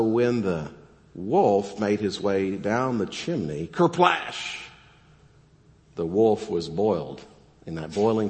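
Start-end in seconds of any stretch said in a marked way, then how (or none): 3.7-4.6 high, far kerplash called out; splash sound effect. Acted. Pastor acts out the wolf hitting the water, high splash call. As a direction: shout the splash like a cartoon.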